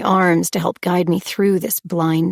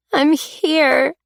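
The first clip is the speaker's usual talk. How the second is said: a higher, broken little voice; weak call, like the world's saddest song; feeble and broken; 9 semitones higher than usual. A narrator's reading. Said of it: "I sounded feeble and broken".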